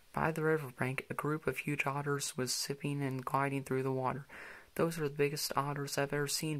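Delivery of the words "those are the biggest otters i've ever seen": ordinary delivery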